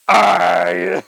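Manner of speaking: sudden loud startled yell; startled shout. Retold or imitated. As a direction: jump back with a shout. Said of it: "I'll be like".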